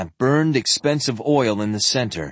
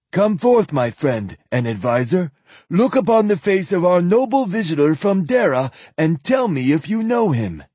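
put-on higher, louder old priest voice; old zealot voice; 7 semitones higher, 3 dB louder than usual. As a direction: call him forth like a grand old priest. Zealot summons advisor.

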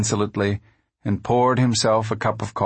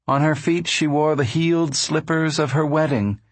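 usual speaking voice

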